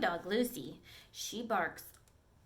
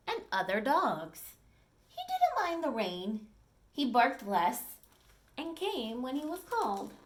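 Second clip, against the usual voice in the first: storybook read-aloud, clear and lively; reading to children; 3 semitones higher, 4 dB louder than usual. Acted. Teacher reading a dog story to the class, lively storytelling. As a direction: read it to the class like a picture book.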